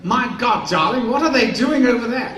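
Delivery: gawp at the strange set; drunk O'Toole. Retold or imitated. O'Toole asking about the set, loud and high.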